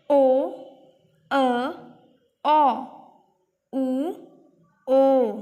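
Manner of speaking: "ओ, अ, औ, उ, ओ" as separate vowel sounds, mostly loud; teacher listing five sounds of O; phonics sound demo. Shown as a demonstration. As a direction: call out each vowel "ओ, अ, औ, उ, ओ" with a pause after it. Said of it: "कितनी phonic sound है इसकी?".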